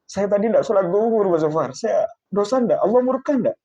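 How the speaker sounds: high, plaintive imitation of a weeping man; retold tearful worry. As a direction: ask anxiously, as if mildly tearful. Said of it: "Nangis beliau".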